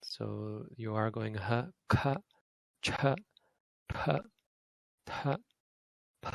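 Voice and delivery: "k, ch, p, t" with a breathy puff; aspirated drill; aspirated consonants. Shown as a demonstration. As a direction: push air after each. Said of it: "is aspirated".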